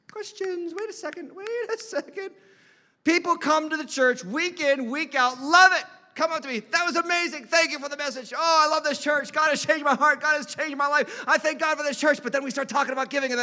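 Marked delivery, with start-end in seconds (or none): 0.1-2.3 very high, hushed mock hesitation; suddenly doubtful. Mimicking suddenly suspicious churchgoers. 6.6-12.2 high, effusive mock praise; gushing churchgoer. Acting out enthusiastic churchgoers' praise.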